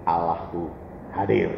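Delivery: low, soft, solemn declaration; hushed reverence. Retold or imitated. Believer telling the roommate God is present, hushed and low. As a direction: confide it deeply and softly.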